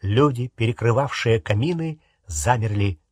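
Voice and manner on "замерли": ordinary delivery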